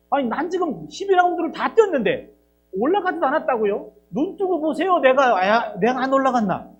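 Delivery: indignant protest. Acted. Preacher voicing Jeremiah's protest.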